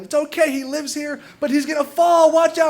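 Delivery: high-pitched hammed-up shout of warning; retold yelling. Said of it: "the neighbor is yelling".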